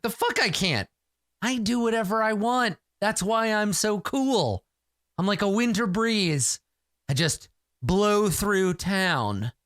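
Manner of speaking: high, smug bragging, slowing at the end; cocky swagger. Acted. Hero brushes off the warrior with smug bravado. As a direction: show off how cool and untouchable you are. Hero brags she is cool.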